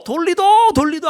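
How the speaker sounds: high-pitched Gyeongsang dialect plea. Shown as a demonstration. Preacher voicing a plea to turn time back, Gyeongsang dialect. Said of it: "경상도 말로".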